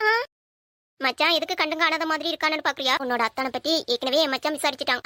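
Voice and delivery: ordinary talk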